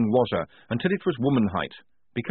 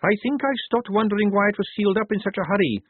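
high-pitched uneasy soldier; Tonka; 11 semitones higher, 3 dB louder than usual. A narrator's reading. Soldier answering the officer in the dark, high character voice. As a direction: pitch the whole line well up, as the soldier. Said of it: "said Tonka".

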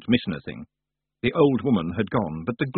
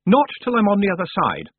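high, firm refusal; 10 semitones higher, 4 dB louder than usual. A narrator's reading. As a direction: stand your ground with the ferryman. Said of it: "Roland said firmly".

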